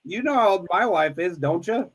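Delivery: ordinary talk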